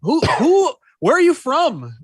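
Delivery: high, loud, disbelieving exclamation; incredulous outburst. Real, not acted. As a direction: fling the question out in amazed disbelief. Incredulous outburst at show creator.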